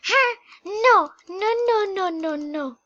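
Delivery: high, loud panicked nos; high squealing no no no. Real, not acted. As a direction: squeal in alarm at the screen. Panicked reaction in game.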